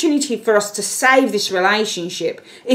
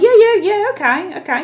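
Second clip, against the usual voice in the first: high, keen yeah-yeah of an eager partner; mock-eager partner babbling yes; 11 semitones higher than usual. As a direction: gush agreement to win them back. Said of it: "this person will be like".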